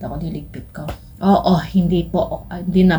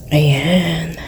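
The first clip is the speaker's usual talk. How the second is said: whisper. Real, not acted. Whispering to the camera.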